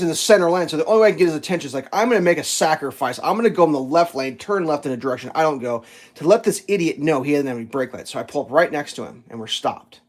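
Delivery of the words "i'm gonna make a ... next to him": usual speaking voice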